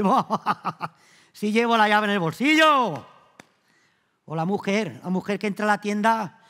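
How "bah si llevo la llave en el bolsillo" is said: laughing. Retold or imitated. Shepherd answers, laughing.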